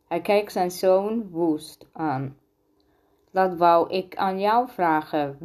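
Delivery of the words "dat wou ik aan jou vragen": ordinary delivery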